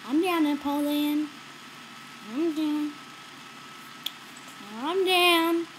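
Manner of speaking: ordinary talk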